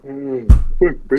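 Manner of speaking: slow, loud yawning groan. Real, not acted. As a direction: yawn through the words. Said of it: "oh, fuck, here I go".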